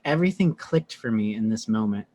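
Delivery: normal talking voice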